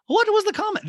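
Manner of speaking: high, loud mock demand; Dorit. Castmate demands the comment from Crystal, high mock exasperation. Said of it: "And Dorit's like".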